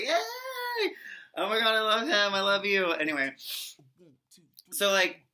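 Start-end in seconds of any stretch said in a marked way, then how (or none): 0.0-2.9 shrieking high Yay then loud gushing; squealing fangirl. Retold or imitated. Fan squealing at the singer's smirk, high and loud. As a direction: squeal like you can't contain it. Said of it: "and I was like".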